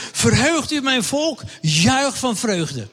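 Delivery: slow, high, solemn proclamation of scripture; preacher quoting Zacharia to the children, slow and solemn; prophet's words. Acted. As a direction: proclaim it like a prophet calling the people to rejoice. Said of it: "die zegt het volgende".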